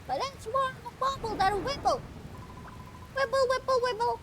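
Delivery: high, squeaky fretful little critter; high fretful creature voice. Acted. Voicing nervous creature NPC.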